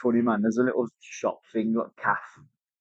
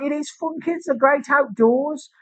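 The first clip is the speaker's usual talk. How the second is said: mock cheerful; 13 semitones higher, slower than usual. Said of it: "I was like".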